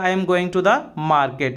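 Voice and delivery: slow, carefully spelled-out English phrase; slow and clear classroom model phrase; slow teaching demo. Shown as a demonstration. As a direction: say each word slowly for the learner. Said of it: "तो बोलेगा".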